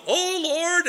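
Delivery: high call, as if from far away; distant echoed prayer. Retold or imitated. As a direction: send the prayer further down the line. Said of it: "And down the road".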